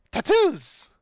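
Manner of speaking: excited shout. Acted.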